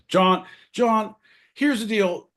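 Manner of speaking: high, loud exasperated call of John; hammed-up scolding of his partner; calling out John in mock despair. Mock lecturing his co-host.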